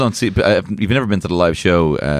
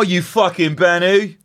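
mock insult; 9 semitones higher than usual. Insult using new word.

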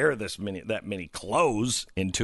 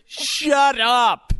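high, loud cry of frustration; exasperated shout; 13 semitones higher, 7 dB louder than usual. Real, not acted. Host fed up with ibuprofen advice, shouting. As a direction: yell it out, fed up.

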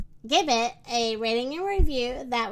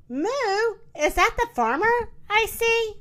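high, louder alarmed call; raised, alarmed storybook question; yelled; 8 semitones higher, 4 dB louder than usual. A narrator's reading. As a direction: cry out a warning to a friend. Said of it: "she yelled".

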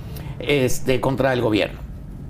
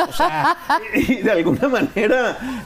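laughing through the words, higher; 9 semitones higher than usual. Real, not acted. Host laughing at how prudish Chileans are, laughing. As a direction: chuckle while saying it.

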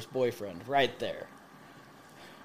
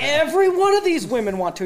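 high, louder retold insistence; emphatic insistence; 10 semitones higher, 5 dB louder than usual. Teller convincing a doubtful stranger, emphatically insistent. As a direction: insist as if it's blindingly obvious.

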